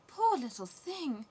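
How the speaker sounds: put-on a prince and princess sighing over a poor child; royal pity.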